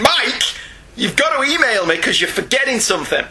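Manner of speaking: lightly put-on nag, pitched high; nagging voice. Host as the nagging voice in Mike's head, mock nagging. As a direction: nag the listener with a high, insistent call. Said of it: "it was my voice saying".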